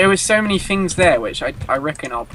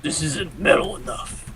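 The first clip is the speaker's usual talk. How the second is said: unvoiced, softer put-on rasp; whispered villain line; 4 dB quieter than usual. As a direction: hiss it like a grumbling warlord. Joking in character voice.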